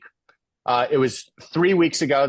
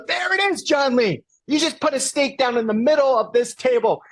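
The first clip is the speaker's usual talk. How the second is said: high, exultant exclamation; retold elation; 12 semitones higher than usual. Speaker hugging John Lee over his dream, retold elation. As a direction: celebrate in a much higher pitch.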